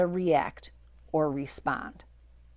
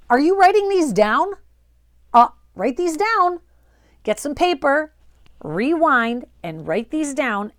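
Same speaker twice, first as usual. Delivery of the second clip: higher and louder, eager push; excited urging; 7 semitones higher, 8 dB louder than usual. Real, not acted. Coach urges viewers to write the lines down, excited and loud. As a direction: fire them up to grab paper.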